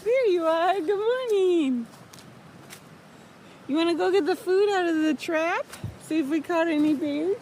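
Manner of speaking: high, bright voice for the dog; happy pet talk. Real, not acted. Greeting the dog happily.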